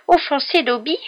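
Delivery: high, loud tearful elf cry; sobbing elf. A narrator's reading. Elf stunned by Harry's apology, wailing sobs. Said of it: "sanglota l'elfe".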